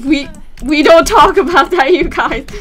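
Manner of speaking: loud mock-scolding to viewers; loud flustered outburst. Real, not acted. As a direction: blurt it out embarrassed. Embarrassed outburst.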